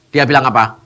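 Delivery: usual speaking voice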